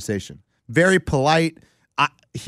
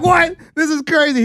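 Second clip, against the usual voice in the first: high, loud, incredulous burst; amazed outburst; 5 dB louder than usual. Retold or imitated. Golfer retelling hearing a star scold himself, amazed. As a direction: exclaim in thrilled disbelief, much higher than usual. Reenacting his amazed reaction to Jordan.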